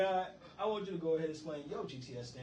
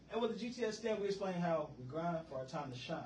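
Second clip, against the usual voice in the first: normal talking voice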